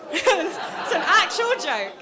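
slow, high, laughing through it. Real, not acted. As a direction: giggle while saying it. Laughs at his own joke.

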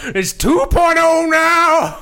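slow, loud shouted retort; shouting. Acted. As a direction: yell it out slowly. Shouted retort in role play.

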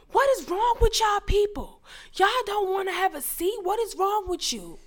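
very high mock indignation; imitating loud man. Man berating passengers who won't take his seat, high mock outrage. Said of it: "there was this loud-ass man".

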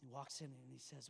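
normal talking voice

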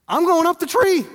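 high, loud hammed-up fright; mock panic. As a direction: bail out in a comic high panic. Scared shepherd fleeing lion.

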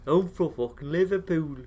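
mock Scouse-Irish impression. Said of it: "just do a fucking Simpsons version".

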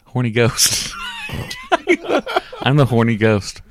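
giggling through the words; laughing. Real, not acted. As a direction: crack up at your own joke. Cracking up at own joke.

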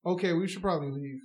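low, unruffled deadpan; mock nonchalant suggestion; straight-faced. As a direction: imitate a coworker unmoved by danger. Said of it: "straight, straight face".

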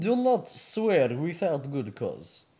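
put-on slow, stern Russian accent. Vladimir scolding co-host for swearing, Russian accent.

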